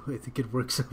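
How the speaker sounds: ordinary delivery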